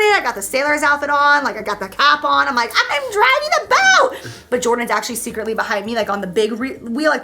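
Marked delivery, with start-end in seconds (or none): 2.9-4.3 high, loud, drawn-out mock triumph; laughing high-pitched brag; gleeful boasting. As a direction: crow proudly like a kid at the helm. Acts herself proudly steering a yacht.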